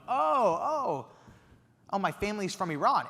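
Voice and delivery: high, sudden oh oh as the penny drops; flustered realization. Retold or imitated. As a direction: stammer out the real answer. Flustered by the trick question.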